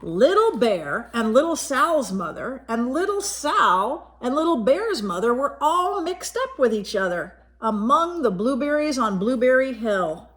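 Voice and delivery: plain reading voice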